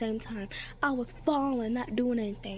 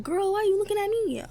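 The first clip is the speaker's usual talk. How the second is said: sassy retort; 10 semitones higher, 4 dB louder than usual. Retold or imitated. Sassy retort voiced in story.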